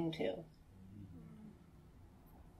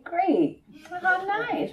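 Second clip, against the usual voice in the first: gushing; 12 semitones higher, 3 dB louder, much slower than usual. Retold or imitated.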